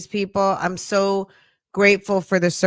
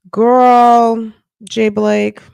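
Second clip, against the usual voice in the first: excited shout-out; 5 semitones higher, 8 dB louder, much slower than usual. Real, not acted. Calling out to a viewer.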